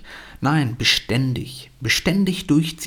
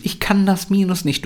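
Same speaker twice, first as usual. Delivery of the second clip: put-on higher-pitched child protesting; klagendes Kind; 7 semitones higher than usual. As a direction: play a kid who gives up. Child complains, parent answers. (hoch gesprochenes, klagendes Kind)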